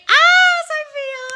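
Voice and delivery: piercingly high, loud squeal; overjoyed high-pitched outburst; delighted squeal. Real, not acted. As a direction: shriek the name, thrilled for her. Squeals at fan's good news.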